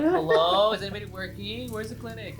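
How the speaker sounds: high, loud calling out; lost visitor calling. Retold or imitated.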